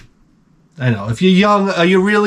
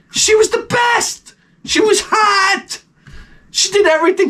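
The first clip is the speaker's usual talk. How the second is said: wistful gushing; 11 semitones higher than usual. Retold or imitated. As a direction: swoon over her like she was perfect. Gushing over first girlfriend.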